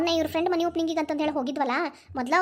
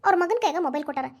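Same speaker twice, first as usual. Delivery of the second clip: ordinary talk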